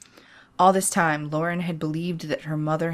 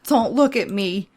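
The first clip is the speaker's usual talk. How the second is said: snapping; 5 semitones higher, 5 dB louder than usual. Acted. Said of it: "She snapped her daughter".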